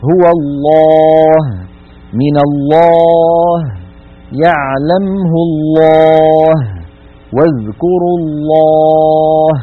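الله slow, lam dark and full; heavy lam. Shown as a demonstration. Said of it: "تفخم العرب اللام بإجماع من لفظ الجلالة". (تفخيم لام الجلالة)

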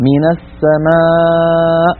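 السماء with a thin alif after the م; light alif. Shown as a demonstration. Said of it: "خرجت الميم مرققة فتبعتها الألف". (ألف مرققة)

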